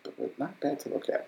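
cheeky whisper under the breath; whispered aside. Real, not acted. Aside to friends about the first lady, whispered. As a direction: slip it in as a sly whisper.

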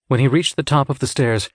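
plain reading voice

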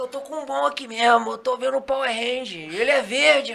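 hamming up the star's amazed greeting; imitating Catra. Storyteller imitates Catra seeing the Green Ranger, hammed up. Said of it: "foi desse jeito ele".

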